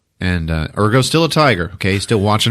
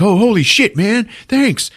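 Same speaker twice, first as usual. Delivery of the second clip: startled thanks; 12 semitones higher than usual. Retold or imitated. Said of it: "He's like".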